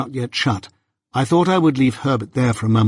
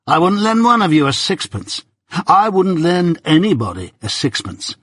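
higher, blunt and boastfully mean; surly Drummle; 6 semitones higher than usual. A narrator's reading. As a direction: fling the refusal proudly. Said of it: "said Drummle".

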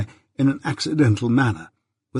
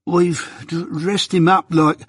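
higher, stumbling nervous start; 6 semitones higher, slower than usual. A narrator's reading. Mike nervously admitting to Jaggers what they did. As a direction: falter over the words. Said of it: "reply in a nervous manner".

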